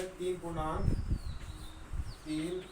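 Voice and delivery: तीन पूर्णांक एक बटा चार read slowly with long pauses; slow reading aloud. Shown as a demonstration. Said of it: "इसको ऐसे पढ़ेंगे". (तीन पूर्णांक एक बटा चार को धीरे-धीरे रुककर पढ़िए)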